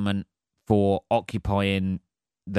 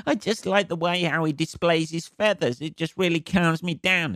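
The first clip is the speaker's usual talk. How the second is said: higher-pitched mock passenger voice; doting pet owner; 6 semitones higher than usual. Passenger admiring a support peacock, doting mock voice. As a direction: gush fondly over the bird, higher.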